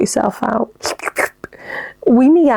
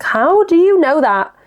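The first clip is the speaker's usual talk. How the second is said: puzzled; 7 semitones higher than usual. Retold or imitated. Her teasing response to fart warning.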